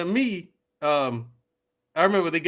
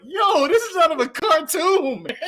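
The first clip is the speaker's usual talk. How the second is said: laughing disbelief; 10 semitones higher, 3 dB louder than usual. Retold or imitated. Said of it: "And it's like".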